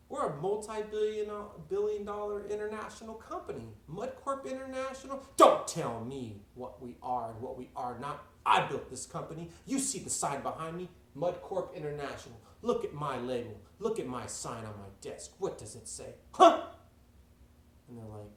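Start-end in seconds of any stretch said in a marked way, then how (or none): 0.1-5.2 very high, loud scientist voice; high-pitched, insistent underling; acted protest. Scientists protest to their boss. 5.4-16.7 loud, indignant tirade; acted blustering rant ending on a blasted question; angry boss. Boss angrily overrides the scientists.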